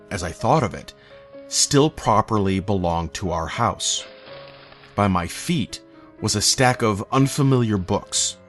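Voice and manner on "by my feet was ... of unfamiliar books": plain reading voice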